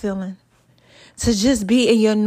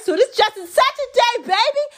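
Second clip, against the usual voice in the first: high, bright-voiced news flash; announcing good news up high; excited announcement; 13 semitones higher than usual. Real, not acted.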